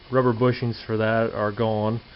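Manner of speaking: ordinary delivery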